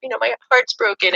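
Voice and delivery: mad at mom. Retold or imitated. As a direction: heartbroken teen snapping at mom. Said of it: "I got really mad at her".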